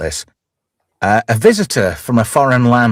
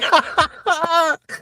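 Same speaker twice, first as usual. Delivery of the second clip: high laugh; 4 dB quieter than usual. Real, not acted. Amused by name correction.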